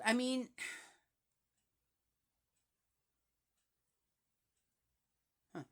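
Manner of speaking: normal talking voice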